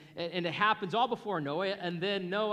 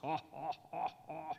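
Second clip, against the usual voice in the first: mock villain laugh; 7 dB quieter than usual. Giant mocks Israel's army.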